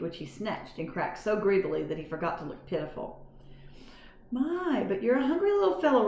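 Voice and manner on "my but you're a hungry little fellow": higher, quick kind auntie voice. A narrator's reading. Kind aunt cooing at a hungry mouse. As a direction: coo it brightly to the mouse.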